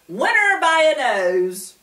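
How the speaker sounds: plain reading voice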